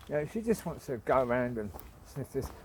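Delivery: ordinary talk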